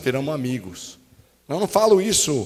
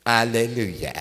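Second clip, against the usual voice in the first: "Aleluia" arrastado, grave e sem vontade; louvor sem vontade; 6 semitones lower, slower than usual. Shown as a demonstration. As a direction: diga "Aleluia" só por obrigação, mais grave. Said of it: "Então fiz assim, ó". ("Aleluia" de má vontade, de propósito péssimo)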